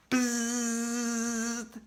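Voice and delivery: nasal-free flat buzz imitating a quiz buzzer. A narrator's reading. Reader imitates the quiz buzzer, steady buzz. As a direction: make the buzzer noise with your lips.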